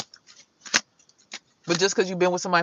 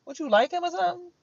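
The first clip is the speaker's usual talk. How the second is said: much higher, disbelieving question; incredulous huh; 11 semitones higher than usual. Retold or imitated. Mother reacting to her son's matchmaking, incredulous. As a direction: react like your son said something absurd. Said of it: "I'm like".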